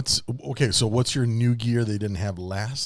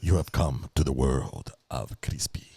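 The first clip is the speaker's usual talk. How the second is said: dramatic trailer voice; 11 semitones lower, 5 dB quieter than usual. Pretend. Low slow dramatic announcement.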